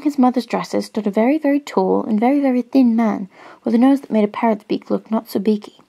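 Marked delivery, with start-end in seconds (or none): none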